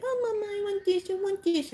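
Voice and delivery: put-on high, wheedling little-girl voice; sing-song child pleading with Mama; whiny child. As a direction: beg like a small child after a treat. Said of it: "trying to be like".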